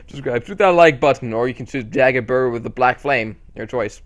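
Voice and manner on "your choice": ordinary delivery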